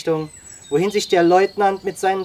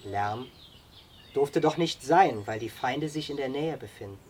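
low, understated reply; simple and modest; 3 semitones lower, 7 dB quieter than usual. A narrator's reading. Youth explaining why noise was forbidden, modest and quiet. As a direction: answer softly, playing it down. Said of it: "antwortete einfach und bescheiden".

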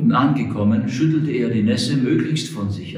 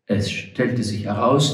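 ordinary talk

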